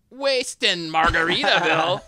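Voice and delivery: high, loud, slow mangled pirate line; hammed-up contestant flubbing it; imitated botched attempt. Producer mimicking the third contestant, hammed-up botched attempt. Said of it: "if the third person's going".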